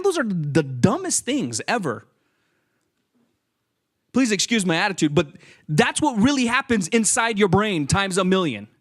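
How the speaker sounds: ordinary talk, nothing marked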